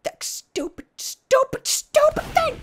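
very high, frustrated repeating; high-pitched fuming; exasperated squeal. Real, not acted. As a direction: build up to a squeaky outburst. Exasperated at game object.

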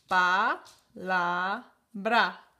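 palabra split into Pa, la, bra with long pauses between; syllable by syllable. Shown as a demonstration. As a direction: sound out Pa, la, bra slowly. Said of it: "Hay tres sílabas". (separa palabra en sílabas despacio)

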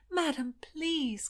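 high, slow plea; begging. Acted. Niece begging her aunt to understand, slow and high. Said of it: "Constance begged".

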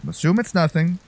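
ordinary delivery

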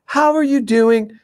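mom's sugary phone voice, pitched high; imitating a mother answering the phone all sweetness; sweet phone voice. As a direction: switch to syrupy sweetness, as if nothing happened. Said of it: "So sweet, so kind".

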